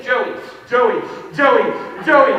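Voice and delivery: loud repeated name call, rising; mock teacher yelling Joey. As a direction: call out to a kid who won't answer. Teacher calling a child again.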